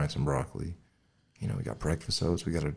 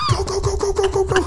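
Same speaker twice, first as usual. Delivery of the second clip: yelling go; 9 dB louder than usual. Retold or imitated. Yelling at driver to flee.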